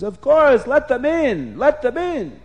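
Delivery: high, excited command; eager king. A narrator's reading. King orders the gift-bearing boys let in, eager. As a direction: burst out with impatient delight.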